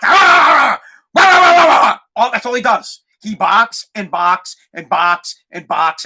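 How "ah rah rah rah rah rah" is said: loud, high yapping bark; imitated dog barking. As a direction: bark like an annoying little dog. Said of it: "and he barks".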